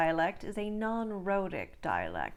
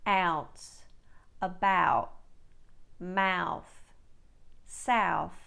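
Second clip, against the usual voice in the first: louder broad ow in each word; Australian ow word drill, louder; 3 dB louder, much slower than usual. Shown as a demonstration. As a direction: stress the wide Australian ow. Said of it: "Let's try some words and phrases for practice".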